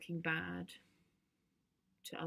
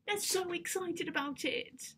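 tag; 11 semitones higher, slower than usual. Acted. Teen reporting friends' excitement to dad.

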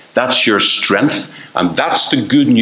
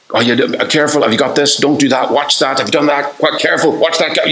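higher-pitched fretful stream of warnings; anxious parent; 6 semitones higher than usual. Acted. Preacher mimics a parent warning the children, anxious and high. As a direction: fuss like a worried parent.

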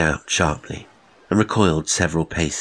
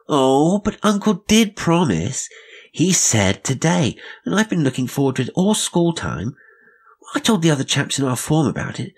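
put-on a high child's voice, louder, rushing on eagerly; pleading boy; 8 semitones higher, 3 dB louder than usual. Boy begs uncle to see the microscope, high and pleading. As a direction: beg like a ten-year-old who was promised.

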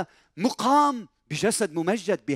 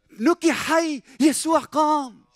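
loud, high, slow triumphant announcement; joyful proclamation; 9 semitones higher, 4 dB louder, slower than usual. Acted. Preacher announcing to Mary that her son lives, loud and joyful. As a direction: cry out the good news slowly. Preacher voices the news of resurrection.

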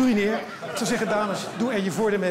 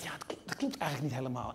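low, whispered doubt; little inner voice; 8 semitones lower, 10 dB quieter than usual. Acted. The comedian's own little voice objecting, whispered. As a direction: whisper it like a nagging conscience. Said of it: "het stemmetje wat zegt".

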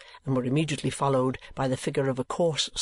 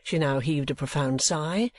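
usual speaking voice

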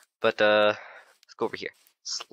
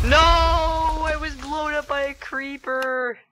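very high, drawn-out wail, loud at first; long howling cry of defeat; anguished wail; 3 dB louder, much slower than usual. Real, not acted. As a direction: wail the loss out slowly up high. Killed by a creeper.

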